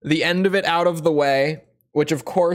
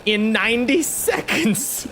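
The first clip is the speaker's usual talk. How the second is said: cartoon villain voice; 5 semitones higher than usual. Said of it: "the goofy cartoon villain voice".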